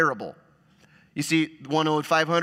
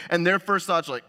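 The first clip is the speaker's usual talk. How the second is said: normal talking voice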